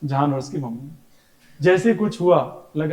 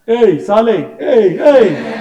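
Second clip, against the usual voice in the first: loud, higher mock yell at someone; mock shout; 4 semitones higher, 9 dB louder, much slower than usual. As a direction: bark at him like the kid does. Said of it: "कौन सा?". (मज़ाकिया ज़ोरदार डाँट)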